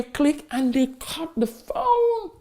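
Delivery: laughing. Real, not acted. Phone hung up, laughing.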